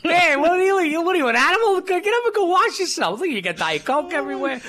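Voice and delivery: high, loud telling-off; mock exasperated scolding. Acted scolding over the soaked bed.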